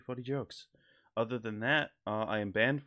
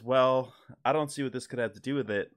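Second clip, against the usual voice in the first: excited Norm cadence; 3 semitones higher, 5 dB louder than usual. Retold or imitated. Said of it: "talking like he's so excited to be telling you this story".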